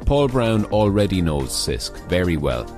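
normal talking voice

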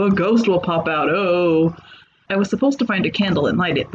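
ordinary delivery, nothing marked

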